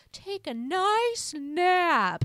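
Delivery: high, loud, drawn-out singsong; Wayne impression. Imitating dad saying the forbidden word, hammed-up singsong. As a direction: stretch it out in a loud put-on dad voice.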